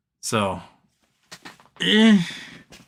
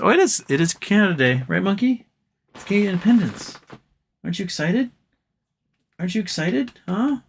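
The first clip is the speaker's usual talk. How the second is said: high, sing-song talk to his dog Monkey; teasing his dog Monkey in a high pet voice; 7 semitones higher than usual. Real, not acted. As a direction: coo at the dog like a pet owner.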